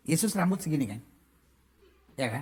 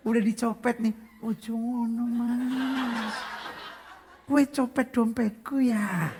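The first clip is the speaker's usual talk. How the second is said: slow, soft and polite; mock sweet Javanese rebuke; gentle Javanese scolding; 4 dB quieter, slower than usual. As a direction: scold the pickpocket ever so gently. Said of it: "lebih lembut daripada kain sutra".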